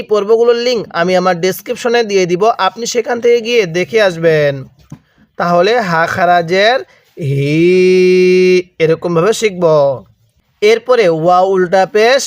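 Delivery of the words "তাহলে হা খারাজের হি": "হি" stretched out for one alif length, very slow; long drawn vowel. Shown as a demonstration. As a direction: hold the final "হি" long like a chant. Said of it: "এক আলিফ টেনে পড়তে হবে".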